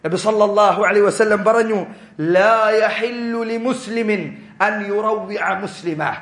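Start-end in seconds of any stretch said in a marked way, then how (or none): 2.2-6.1 slow, solemn Arabic recitation; measured chanted Arabic hadith. Acted. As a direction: recite the Prophet's words slowly, like scripture. Prophet's hadith quoted in Arabic.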